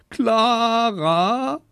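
high, loud mock-crying whine; hammed-up child's bawl; tattling wail. A narrator's reading. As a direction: wail the sister's name to mum, rising at the end. Boy wails the culprit's name.

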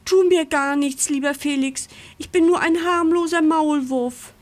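high-pitched anxious begging; fearful pleading. A narrator's reading. Trembling mole begs the farm dog for mercy, frightened pleading. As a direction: plead high, hoping to be spared. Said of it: "fängt vorsichtshalber schon mal an zu zittern".

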